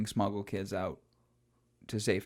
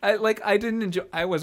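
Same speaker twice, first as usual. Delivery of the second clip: much higher, louder sheepish sorry; squeaky apology; 13 semitones higher, 7 dB louder than usual. Real, not acted. Host apologising to Mario for the gross image, high and sheepish.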